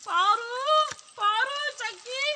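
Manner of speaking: put-on loud, drawn-out call of the children's names, slow. Mother calling her daughters as the rain comes, loud and slow. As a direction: shout for the kids across the yard.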